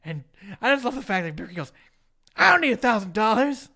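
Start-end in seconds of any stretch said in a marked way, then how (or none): 2.4-3.7 louder, higher mock outburst; imitated loud protest; indignant refusal. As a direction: proclaim it like a wrestler's indignant retort. Retelling Booker's indignant refusal.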